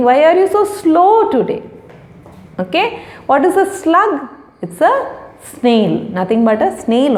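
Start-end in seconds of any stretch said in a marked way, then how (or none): 0.1-1.6 mock scolding question.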